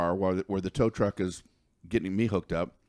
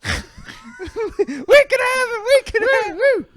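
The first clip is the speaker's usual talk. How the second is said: jubilant hollering; 9 dB louder than usual. Retold or imitated. Hunters running back with the deer, ecstatic high hollering.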